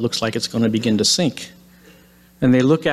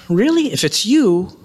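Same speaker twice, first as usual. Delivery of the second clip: mildly bold, raised pitch; bold speaking up; 7 semitones higher, 4 dB louder than usual. Retold or imitated. Simon speaks up to the figure on the water, mildly bold. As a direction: speak up as a retold brave disciple. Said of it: "ever brave Simon speaks up".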